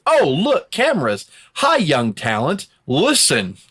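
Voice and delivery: mock self-promoting politician, high and drawn out. Podcaster mocking a wrestler who helps talent for cameras, mock politician. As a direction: put on a showy mentor act for the cameras, slowly. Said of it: "this politician that goes out".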